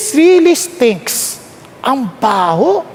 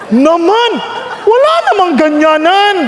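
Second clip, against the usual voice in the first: laughing protest; 5 semitones higher than usual. Retold or imitated. Protesting God's request, laughing.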